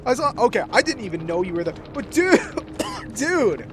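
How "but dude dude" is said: high exasperated calls of dude. Real, not acted. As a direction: call out in disbelief. Streamer exasperated at teammate.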